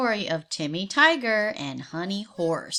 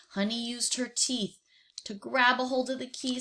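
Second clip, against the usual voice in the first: usual speaking voice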